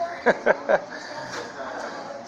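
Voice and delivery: breathy, soft chuckle; soft laugh after your own joke. Real, not acted.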